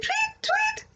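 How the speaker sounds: high chirpy birdlike tweet tweet; bird tweets. Retold or imitated. Mother to child, imitating a bird's high tweet.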